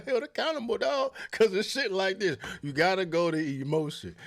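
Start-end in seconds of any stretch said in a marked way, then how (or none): none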